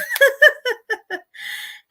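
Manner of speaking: shrieking laugh; long high burst of laughter; laughing at the poor driver. Real, not acted.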